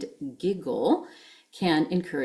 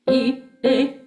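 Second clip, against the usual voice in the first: giggling notes; 8 semitones higher, 5 dB louder than usual. Shown as a demonstration. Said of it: "try giggling that rhythm with me".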